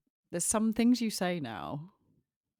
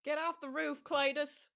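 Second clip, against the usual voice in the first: put-on Southern accent; 12 semitones higher than usual. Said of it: "those are easier to mimic".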